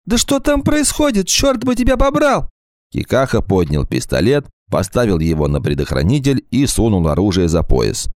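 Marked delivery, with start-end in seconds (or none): 0.0-2.5 high, anxious woman calling out; a frightened wife shouting for Walt; worried woman's voice. Acted. Frantic woman calling on phone.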